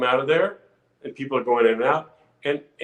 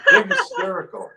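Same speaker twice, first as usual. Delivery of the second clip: laughing. Real, not acted. Laughing while telling audition.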